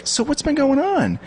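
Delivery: high, slow chatty question; imitating older woman. Barista catching up with him, mock high voice. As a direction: mimic her sing-song small talk. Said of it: "she was like".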